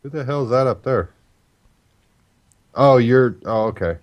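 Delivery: ordinary talk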